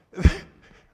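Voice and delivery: loud, high burst of laughter. Real, not acted. Loud laugh after mom story.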